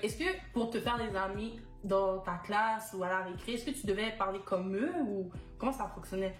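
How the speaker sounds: usual speaking voice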